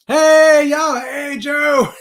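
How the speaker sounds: loud, high, drawn-out holler; big hailing call; shouted greeting. Real, not acted. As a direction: holler hello to a friend joining in. Calling out greeting to viewer.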